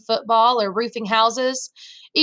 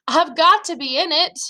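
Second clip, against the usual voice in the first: higher, more insistent inner plea; urgent insistence; 6 semitones higher than usual. A narrator's reading. Boy dreads being made to stay home, urgent insistence. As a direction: press it urgently, pitched up.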